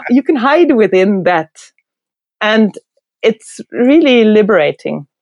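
ordinary delivery

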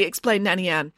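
plain reading voice

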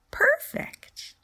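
very high little bunny voice; small toy voice. Acted. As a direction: squeak it like a tiny toy.

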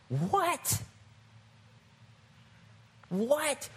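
high, rising disbelief; incredulous. Acted. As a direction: pitch it way up as a startled question. Acts shock at Spirit's demand.

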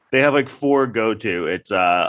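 ordinary delivery

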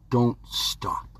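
whispered urging. Acted. Coach whispering to the listener not to give up. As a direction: lean in and whisper it.

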